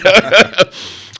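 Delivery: loud laugh. Real, not acted. Laughing after shouting reply.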